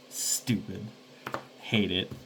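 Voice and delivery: normal talking voice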